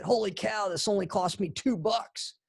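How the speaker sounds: high-pitched excited exclamation, imitating her; excited wife. Wife showing off cheap coffee filters to husband, excited. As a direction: gush like someone thrilled by a bargain. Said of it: "she was so excited".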